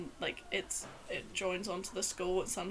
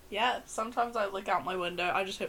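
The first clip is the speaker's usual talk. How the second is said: ordinary talk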